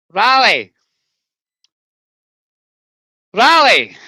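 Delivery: loud call across to Rally. Real, not acted. Calling out loudly to Rally for an answer. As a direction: shout his name to get his attention.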